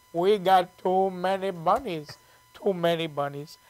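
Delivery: put-on slow, friendly Indian accent. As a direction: imitate an Indian man, drawn out. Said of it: "I love doing that accent".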